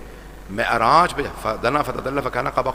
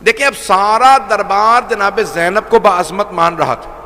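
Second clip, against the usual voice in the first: impassioned preaching; 9 semitones higher, 9 dB louder than usual. Acted. Preacher voicing Zainab to the mourners, loud and high. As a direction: lift the hall with Zainab's words.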